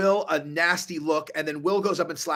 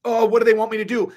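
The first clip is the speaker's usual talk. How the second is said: higher, louder and fast, flustered; mock panicky; 5 semitones higher, 7 dB louder, faster than usual. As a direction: rush it like a nervous people-pleaser. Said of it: "so it's like".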